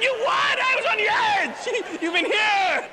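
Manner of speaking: high, loud incredulous yell breaking into laughter; screaming at the gym. Retold or imitated. [name] yelling at Ray in the gym, laughing. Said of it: "I screamed at the gym".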